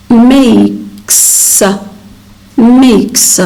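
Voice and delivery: "Makes" drawn out, ending in a hissed s; final voiceless s. Shown as a demonstration. Teacher modelling the verb, "Makes" with a crisp s ending. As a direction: say "Makes" with a separate, unvoiced s. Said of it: "we pronounce the final S as S".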